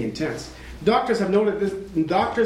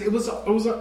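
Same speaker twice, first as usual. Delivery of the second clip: usual speaking voice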